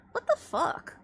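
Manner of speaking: high incredulous what the fuck; shocked. Retold or imitated. Streamer retelling her reaction to the stolen bear, mock outrage.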